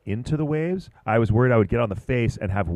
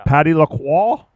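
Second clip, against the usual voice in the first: name said the French way, raised a bit; French accent; 6 semitones higher, 4 dB louder than usual. Shown as a demonstration. Commentator reading a surfer's name in French accent. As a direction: give Lacroix a light French accent. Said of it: "saying his name with a French accent".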